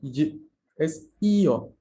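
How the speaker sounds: slow io with two separate vowels, i then o; io, not yo. Shown as a demonstration. Teacher correcting the class: slow, clear io with two vowels. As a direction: say io slowly, no y sound. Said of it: "No es y".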